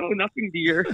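feigned innocence with a laugh in it; mock-meek husband. Caught joking about her as his wife, mock-meek backpedal.

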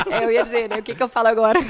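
loud, flustered laughing question. Real, not acted.

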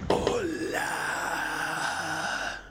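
a cried, eerie hello; eerie monster greeting. Acted. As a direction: call out like a creature in the mind. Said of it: "Você escuta dentro da sua mente". (saudação sinistra de criatura)

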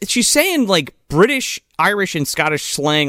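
ordinary talk, nothing marked

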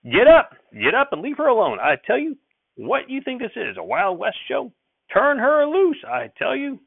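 put-on high, loud scolding old man; shouting. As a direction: holler at the boy to let go. Grandfather shouting at boy.

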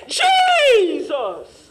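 "Jesus!" shouted high; mock distressed cry. Preacher acting a praying person in distress, shouting. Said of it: "You don't cry out to Jesus like that".

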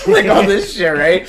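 laughing high as he talks; words spilling through laughter; giddy laughing recap. Real, not acted. Laughing retelling.

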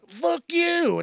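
very high-pitched put-on outburst; mock outraged retort. Parent fires back at bar patron, mock outraged.

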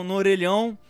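normal talking voice

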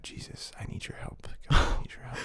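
hushed, fast plea for help; whispered mock prayer. Mock praying to Jesus about his cohost, whispered. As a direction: whisper a desperate prayer as a joke.

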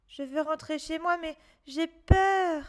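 lightly voiced frightened boy; scared boy. A narrator's reading. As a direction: confess you're afraid like a child. Martin voice in story.

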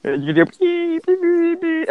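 high, slow sung beeps; imitated censor beeps. Shown as a demonstration. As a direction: beep high and drawn out like a censor tone. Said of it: "The beats will make it funnier though".

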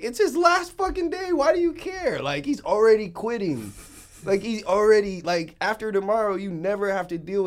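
high exasperated outburst; loud exasperated squeal. Retold or imitated. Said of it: "I'm like".

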